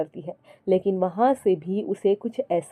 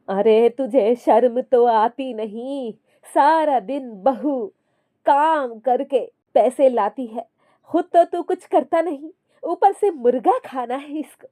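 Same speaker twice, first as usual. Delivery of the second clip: higher, louder stern telling-off; scolding mother; 4 semitones higher, 3 dB louder than usual. Acted. Mother-in-law scolding her son for demanding chicken, stern. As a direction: shame her lazy son.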